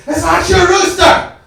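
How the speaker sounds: high, loud, slow shouting; screaming. Retold or imitated. Angry Irish neighbor screaming at the door, loud. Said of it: "She started screaming at me".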